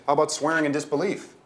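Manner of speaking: griping. A narrator's reading. Bag lady prodding Mister Dangletooth to react, griping. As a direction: keep griping, pitch up. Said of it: "I griped".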